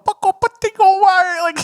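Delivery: high, loud mock-Chinese syllables; fake Chinese gibberish. Imitating friend's prank call, fake Chinese gibberish. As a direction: rattle off nonsense as fake Chinese. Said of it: "like, Chinese gibberish".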